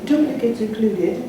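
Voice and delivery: mock-British afterthought, drawn out; playful British affectation, unhurried; British accent. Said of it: "in my British accent".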